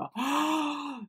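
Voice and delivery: high, soft gasp of delight; excited intake of breath; thrilled gasp. Real, not acted. Thrilled by scent description.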